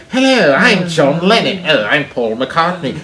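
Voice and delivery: mock cartoon British introductions, lilting; exaggerated Saturday-morning cartoon Englishman. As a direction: caricature a British pop star. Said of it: "when you'd watch the Beatles cartoon".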